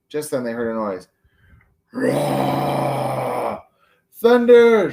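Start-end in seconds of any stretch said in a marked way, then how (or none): 1.9-3.6 a rough mock snore, rah. Norman's snore. 4.1-4.9 high, loud frightened shout of thunder. A narrator's reading. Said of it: "shouted Tim".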